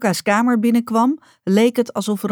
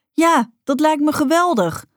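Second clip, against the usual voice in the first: bright eager yes, pitched much higher; enthusiastic; 9 semitones higher, 3 dB louder than usual. A narrator's reading. Rocca to her mother about painting a wall, enthusiastic. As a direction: jump at the idea. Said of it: "zei Rocca enthousiast".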